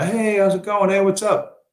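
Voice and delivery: high, sing-song imitation of small talk; mock chummy greeting. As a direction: mimic an unwelcome acquaintance saying hi. Mimicking sudden chummy greeting.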